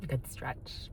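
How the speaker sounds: whispered, almost no voice; soft whisper. Real, not acted. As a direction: hush it to the little one. Talking softly to a stretching pet.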